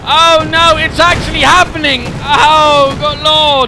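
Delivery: loud, rising excitement; excited shouting. Real, not acted. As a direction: yell it as the chase peaks. Caster watching tank chase climax.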